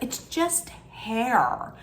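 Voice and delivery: slow, dismissive mock drawl; imitating Demi. Vlogger mocking Demi brushing off Jessie's work, slow and dismissive. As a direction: stretch it out like it's nothing. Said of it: "Demi was like".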